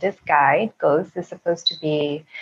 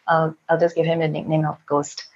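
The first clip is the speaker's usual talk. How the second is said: normal talking voice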